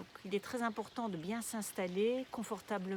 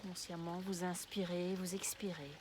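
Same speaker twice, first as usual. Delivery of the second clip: guided meditation; 6 dB quieter than usual. Real, not acted. Guide une relaxation de yoga nidra. (voix douce et posée de méditation guidée)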